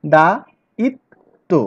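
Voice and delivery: দা ইত তো split into separate beats; teacher sounding out দা ইত তো slowly; syllable by syllable. Shown as a demonstration. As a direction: break দায়িত্ব into দা, ইত, তো with pauses. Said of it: "সিলেবলটা আরো ভালো করে ভাগ করা যায়".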